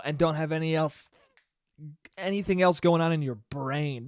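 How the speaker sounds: ordinary talk, nothing marked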